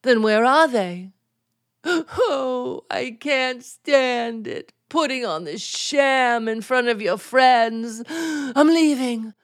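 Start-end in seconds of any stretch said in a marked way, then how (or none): 1.8-9.3 hammed-up tearful farewell; mock sobbing martyr. Wife staging her exit from a sham marriage, mock sobbing.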